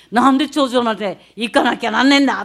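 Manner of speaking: loud, rough complaint in dialect; grumbling student. Retold or imitated. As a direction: play a fed-up schoolboy shouting out. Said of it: "男子の生徒たちが言ってる声". (疲れた男子生徒の大声の愚痴)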